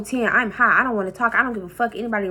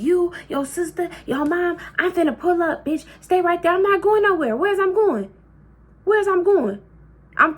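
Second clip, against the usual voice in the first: angry auntie; 5 semitones higher than usual. Retold or imitated. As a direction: play the furious auntie on the phone. Auntie threatening on the phone.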